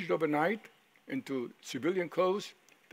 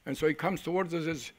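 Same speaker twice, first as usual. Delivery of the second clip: ordinary talk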